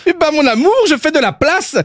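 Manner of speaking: put-on high, Belgian-accented husband voice. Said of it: "mon accent belge". (accent belge, voix haute)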